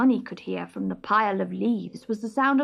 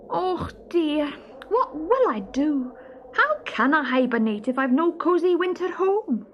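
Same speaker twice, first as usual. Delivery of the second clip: worried little stoat; 8 semitones higher than usual. Acted. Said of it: "sighed Barney".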